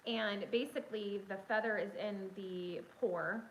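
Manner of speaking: ordinary talk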